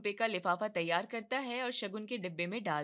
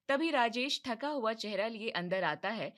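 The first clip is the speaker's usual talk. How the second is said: ordinary talk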